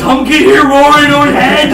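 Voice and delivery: loud, high, slow caveman talk. Pretend. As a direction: drag it out like a dim brute.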